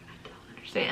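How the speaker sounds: hushed, baffled whisper; whispered confusion. Retold or imitated. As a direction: breathe it out, lost. Confused reading the label.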